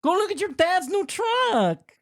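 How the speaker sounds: very high, drawn-out calling voice; Sofia Vergara. Acted. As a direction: call out high, stretching the words. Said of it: "That's my best Sofia Vergara impression".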